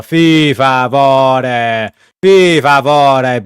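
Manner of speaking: slow, loud mock Italian exclaim; over-the-top fake Italian flourish. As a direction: drag out the words like a hammy Italian.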